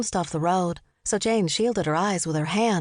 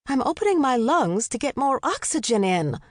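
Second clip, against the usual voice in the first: higher, defensive excuse; defensive protest; 6 semitones higher than usual. A narrator's reading. Mother explaining her panting to her sons, mildly defensive. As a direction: justify yourself with raised pitch.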